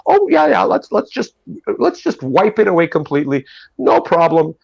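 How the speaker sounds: high, sing-song mock agreement; mock the people who shrug it off; sarcastic agreement. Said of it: "and that people would go".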